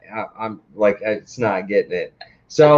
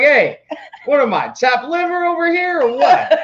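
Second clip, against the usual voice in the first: high, laughing mock Italian complaint; jealous complaint. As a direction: whine it like a slighted wiseguy. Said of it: "I'm like".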